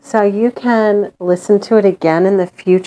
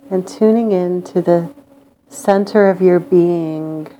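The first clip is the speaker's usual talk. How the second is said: guided meditation. Acted. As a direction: lull them into calm with long pauses.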